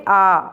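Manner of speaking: आ held about twice as long as अ; long vowel. Shown as a demonstration. Teacher showing students a long आ. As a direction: stretch आ out to double length. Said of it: "ह्रस्व से दुगना समय लगता है".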